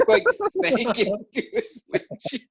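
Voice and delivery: high, laughing through the words; cracking up at his own blunder; real laughter breaking in. Real, not acted.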